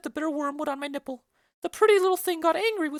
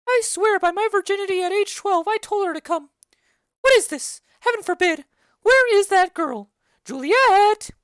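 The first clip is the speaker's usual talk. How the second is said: nurse hollering through the house, louder; calling out; 4 semitones higher, 8 dB louder than usual. Acted. Nurse calls loudly for Juliet, fussing and shouting. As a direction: shout for the missing girl, swearing she told her.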